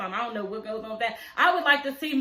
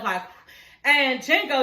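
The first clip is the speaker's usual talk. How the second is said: usual speaking voice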